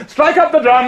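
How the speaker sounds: high, loud order to the drummers; loud command. Acted. King drowns his mother's words with a loud command. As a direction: bark it out to drown her voice.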